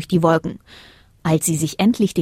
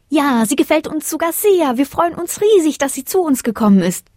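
high, excited small voice; delighted little star; 8 semitones higher than usual. A narrator's reading. Little star answers the moon, delighted. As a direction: gush in a high-pitched voice.

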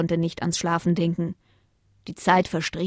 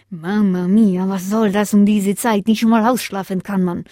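grumpy Italian-tinged grumble; grumpy dog woken in the night; grumbling Roberto; 4 dB louder than usual. Acted. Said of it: "murmelte Roberto".

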